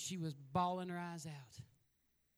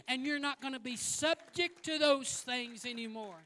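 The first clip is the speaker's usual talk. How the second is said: higher, louder preaching with punched phrases; preaching at full force; 7 semitones higher, 5 dB louder than usual. Acted. Preacher proclaiming a new season to the congregation, loud and high.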